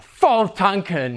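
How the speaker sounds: slow, drawn-out relish; hammed-up satisfaction, stretched out; mock good cheer. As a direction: say it as if all is going great. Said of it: "Things were good".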